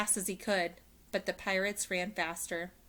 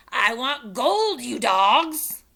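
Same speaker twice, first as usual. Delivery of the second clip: slow loud pirate rant; 5 semitones higher, 12 dB louder, much slower than usual. Acted. Captain rants at crew.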